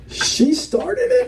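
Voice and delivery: put-on high, slow, childish protest; acted squeaky self-justification; lame excuse. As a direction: plead it sheepishly, rising like a question.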